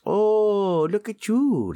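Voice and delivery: high, loud delight. Acted. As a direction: gush over a clever trick. Impressed by the apple juice.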